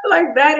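very high, thin wailing cry; high-pitched cry. Retold or imitated. Recalling her hidden cry, a high-pitched sobbing wail. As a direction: squeak out a high sob with a laugh. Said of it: "I cried like a high pitch".

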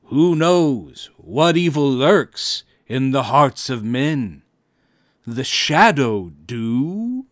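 a mother's mock-spooky Shadow impression, drawn out and loud; the Shadow's catchphrase. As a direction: playfully ham up the old radio Shadow. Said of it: "playfully paraphrase the crime fighter's catchphrase".

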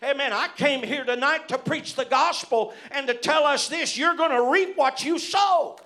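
loud, high, hammering pulpit cadence; full-force preaching. Acted. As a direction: preach it to the rafters, amen-style.

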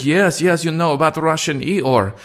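high, insistent put-on voice; imitated Russian woman. Retelling his date's argument about Eeyore, imitated insistent Russian woman.

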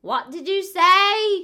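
put-on high, loud, slow old-lady shout; hammed-up hard-of-hearing granny; deaf old lady. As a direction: yell like a grandma who can't hear. Imitating deaf elderly selves.

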